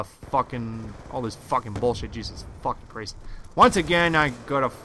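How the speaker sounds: usual speaking voice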